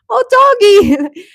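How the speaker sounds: high, loud squeal of delight; excited. Retold or imitated. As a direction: squeal it out at the cute dog. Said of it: "I'm like".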